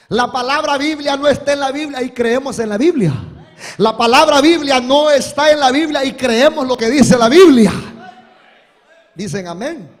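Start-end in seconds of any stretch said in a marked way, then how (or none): 0.1-7.7 louder, laughing as he drives it home; shouted with a laugh. Acted. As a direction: belt it out to the congregation with a laugh. Repeats the point, shouting to congregation. (gritado entre risas)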